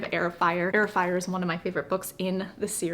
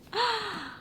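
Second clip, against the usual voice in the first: quick delighted gasp; sharp high gasp; 13 semitones higher, 3 dB quieter than usual. Real, not acted. Noticing the sea serpent on the cover, delighted gasp. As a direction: gasp as if spotting a surprise.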